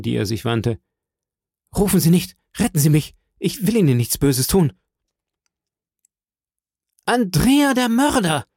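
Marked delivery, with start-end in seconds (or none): 1.7-4.7 higher-pitched, urgent begging; frightened plea. A narrator's reading. Cornered fugitive begs two women not to call, frightened plea. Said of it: "rief er, bleich, verwirrt". 7.1-8.5 alarmed cry. A narrator's reading. Young woman recognises the intruder as a murderer, alarmed cry. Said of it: "rief eine von den jungen Frauen".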